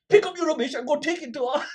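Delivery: high, loud mock announcement; retold cheeky shout, breaking into laughter; imitating lad on mic. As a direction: order him about over the mic, high and loud. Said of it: "And he goes".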